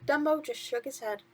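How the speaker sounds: plain reading voice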